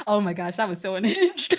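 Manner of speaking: laughing through the words; amused disbelief breaking into laughter; tickled, giggling reaction. Real, not acted. Laughing over shared memory.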